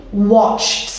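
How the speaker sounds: "Watched" as one syllable ending in ch plus t; strong final t. Shown as a demonstration. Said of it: "it's very strong".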